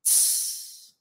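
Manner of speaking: voiceless "tss" cymbal imitation; short hissed cymbal sound. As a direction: hiss like a drum kit cymbal. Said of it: "como é que é o som do prato?".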